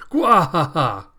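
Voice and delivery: loud, slow booming villain cackle; evil villain laugh. Acted. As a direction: laugh like a gloating monster. King of Worms boasting.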